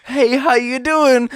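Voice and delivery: mock-sweet slow mom hello. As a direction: play the mom being overly casual. Voicing mom's sudden text.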